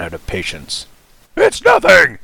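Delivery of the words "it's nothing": much higher, much louder angry snap; a shouted snarl at the nervous girl. A narrator's reading. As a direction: bite the words off in a rage. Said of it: "he snarled".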